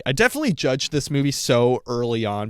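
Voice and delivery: ordinary talk, nothing marked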